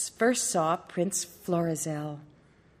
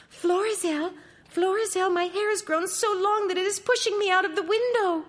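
very high princess calling out; cartoon princess. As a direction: call down from the window, high and urgent. Princess calls from the window.

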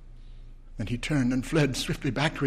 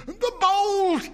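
very high, loud, panting shout; loud cry; 4 dB louder than usual. Acted. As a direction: call down in strained panic. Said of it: "she cried loudly".

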